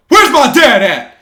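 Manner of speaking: loud high yell, words stretched out; retold shout. Fighter calling out for his dad after the fight, shouting. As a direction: holler it across a crowded venue.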